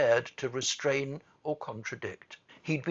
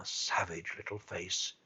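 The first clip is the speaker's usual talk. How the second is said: ordinary delivery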